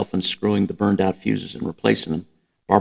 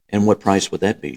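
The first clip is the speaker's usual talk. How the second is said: ordinary delivery, nothing marked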